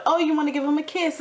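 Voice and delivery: lightly imitating a mom urging her kid; mock coaxing mother. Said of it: "And Nicole's like".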